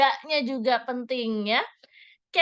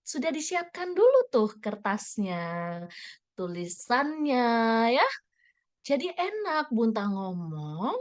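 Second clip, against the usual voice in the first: talking slowly; slower than usual. Shown as a demonstration. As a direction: take your time and ease each phrase out. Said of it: "kira-kira enak mana tuh".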